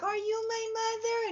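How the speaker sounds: put-on high little-kid voice; childlike voice. Asking her cat if it is her mother, high childlike voice. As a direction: ask it like a small child looking up.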